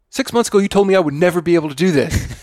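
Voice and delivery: high whiny complaining voice; whiny ex impression. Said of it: "I'm like".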